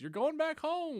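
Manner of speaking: high, slow sing-song echo; mock game voice. Mimicking the game's reply to the player, high slow sing-song. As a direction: parrot it back like a chirpy game.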